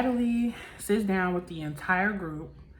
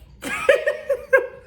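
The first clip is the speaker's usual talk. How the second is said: high, loud mock laugh; imitated laughing; 8 dB louder than usual. Recapper imitating a castmate who starts laughing, high mocking laugh. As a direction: burst into someone else's high laughter. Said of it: "[name] starts laughing".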